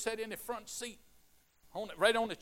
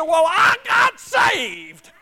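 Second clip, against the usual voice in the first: high, loud, slow triumphant cry; joyful shout; 14 semitones higher, 20 dB louder, much slower than usual. Retold or imitated. Old woman announcing salvation to her preacher, joyful shout. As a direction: stretch it out in pure joy. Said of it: "she was just excited".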